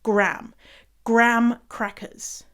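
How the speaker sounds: "Graham" squashed into gram, one syllable; North American Graham. Shown as a demonstration. Demonstrating the Canadian version, one-syllable "Graham". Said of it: "They say Graham".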